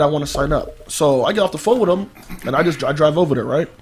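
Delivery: ordinary delivery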